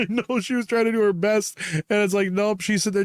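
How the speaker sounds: high voice laughing through the words. Real, not acted. Defending the actor while laughing, high. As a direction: talk while still giggling. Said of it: "I felt so bad for her".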